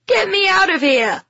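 high, loud panicked yell. A narrator's reading. Boy hammers on the van door, yelled. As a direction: yell frantically, voice shooting up. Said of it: "he yelled".

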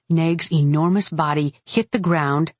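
ordinary delivery

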